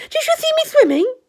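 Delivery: high, loud excited squeak; Roo. Acted. Roo just out of the water, excited high squeak. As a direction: squeak it out, bursting with pride.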